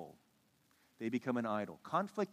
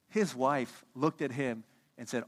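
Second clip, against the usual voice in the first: ordinary talk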